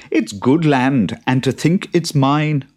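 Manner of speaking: a hint of a proud farmer; Jude gloating. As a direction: savour owning the land. Jude voiced in story.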